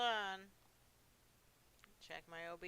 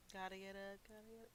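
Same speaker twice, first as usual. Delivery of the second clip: much softer each time; trailing off, softer and softer; fading mumble; 11 dB quieter, faster than usual. Acted. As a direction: let it die away to nothing. Repetition fading off.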